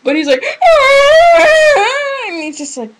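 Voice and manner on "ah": high, loud mock crying wail. Imitating a rejected boy wailing, loud mock wail. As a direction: ham up a sobbing man's wail. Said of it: "wailing and sobbing".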